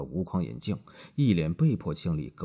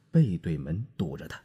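ordinary delivery

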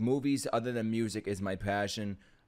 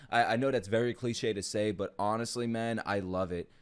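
normal talking voice

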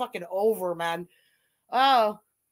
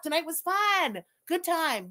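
ordinary talk, nothing marked

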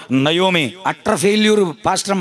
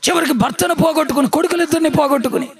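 high-pitched, urgent sermon delivery; impassioned preaching; 8 semitones higher than usual. Real, not acted. Preacher recounting Naomi's losses to the congregation, impassioned and high.

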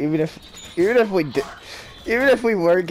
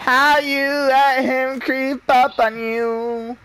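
usual speaking voice

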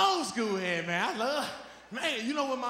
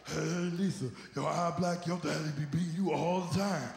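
lowered cartoon voice; 6 semitones lower, 6 dB quieter than usual. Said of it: "He was, like, nosy as hell".

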